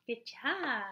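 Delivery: plain reading voice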